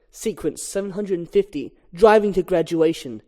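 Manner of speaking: usual speaking voice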